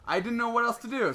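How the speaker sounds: normal talking voice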